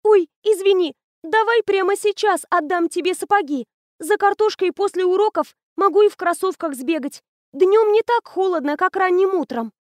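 put-on the girl explains to the principal innocently; innocent schoolgirl. A narrator's reading. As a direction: play the sweet innocent. Anya voiced.

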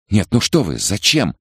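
worried woman pleading; alarmed protest. A narrator's reading. Frightened woman pleads with attackers.

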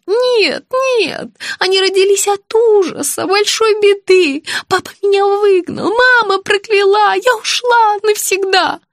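high, agitated lament; despairing. A narrator's reading. Patient recalling her parents casting her out, despairing. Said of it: "с отчаянием воскликнула бедняжка".

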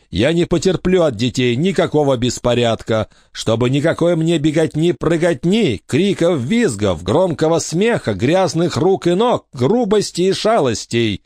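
prim, high, disapproving matron voice; a stern lady warning the orphans of her rules; stern strict lady. A narrator's reading. Stern lady lists forbidden things.

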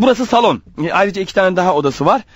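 usual speaking voice

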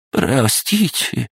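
high, slow, pathetic plea; pitiful babble. A narrator's reading. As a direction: whimper it like a coward. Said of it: "жалко пролепетал Филимонов".

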